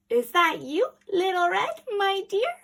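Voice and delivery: put-on old lady calling out; calling granny. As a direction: call through the door sweetly. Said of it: "called Granny".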